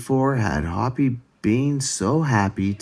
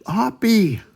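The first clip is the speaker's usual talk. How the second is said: family calling out, rising to a high cheer; relieved family shouting for the lost hare; excited calling; 10 semitones higher, slower than usual. A narrator's reading. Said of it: "they cried".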